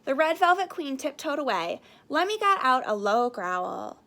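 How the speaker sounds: usual speaking voice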